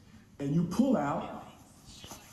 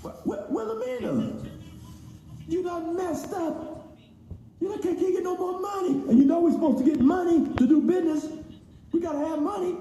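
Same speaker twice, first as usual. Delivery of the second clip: mock scolding; 12 semitones higher than usual. Customer scolding his failed company in a high mock voice. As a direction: chide her like a fed-up auntie.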